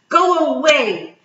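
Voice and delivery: put-on child's furious yell, high and loud; angry shout. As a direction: scream it at grandfather, pushing him away. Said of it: "On shouted".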